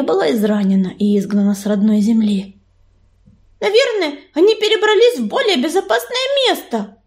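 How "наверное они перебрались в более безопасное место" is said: high, eager, hopeful voice; light up with sudden hope, pitch raised; beaming hope. A narrator's reading. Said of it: "просияла Белочка". (радостно, с надеждой, высоким голосом)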